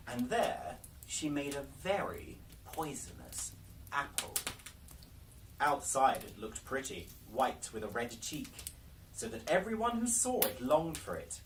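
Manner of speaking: ordinary delivery, nothing marked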